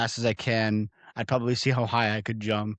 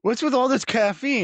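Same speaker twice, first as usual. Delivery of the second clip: high whiny voice; whiny demon; 13 semitones higher, 5 dB louder than usual. Retold or imitated. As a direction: whine it high. Joins the meme voice.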